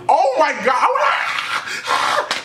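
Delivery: very high, drawn-out mock scream; hammed-up squeal of pain; reenacted screaming. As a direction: wail as if getting beaten. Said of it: "Like, I'm screaming".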